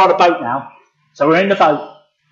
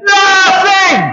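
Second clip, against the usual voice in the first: high, loud drawn-out shout; calling back; 11 semitones higher, 5 dB louder than usual. Acted. Fishermen answer the man on shore, calling back loudly. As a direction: yell it back across the water.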